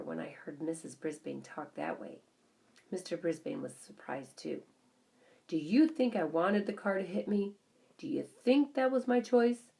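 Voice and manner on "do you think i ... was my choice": louder, higher, hurt; bitter protest. A narrator's reading. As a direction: throw the question back at her. Husband bitterly defends himself.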